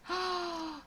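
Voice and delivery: sharp delighted gasp; excited gasp. Real, not acted. Sees viewer's quilt.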